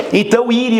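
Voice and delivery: usual speaking voice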